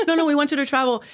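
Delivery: lightly acted, high insistent reply; mock insistence. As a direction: playfully imitate bosses brushing off a refusal. Retold bosses insisting.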